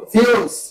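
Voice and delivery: ফিউলস as "fyools", set apart; English pronunciation. Shown as a demonstration. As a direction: model ফিউলস with a fyoo glide and a fall. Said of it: "উচ্চারণ কি?". (ফিউলস, ইংরেজি ধাঁচে ফিউ-লস উচ্চারণ)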